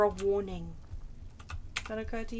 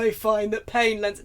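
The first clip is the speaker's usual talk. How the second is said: lightly voiced emo vampire, louder; a hint of brooding vampire, pushed louder; Trevor; 5 dB louder than usual. Player trying Trevor's accent, lightly voiced impression. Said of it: "E-, emo vampire".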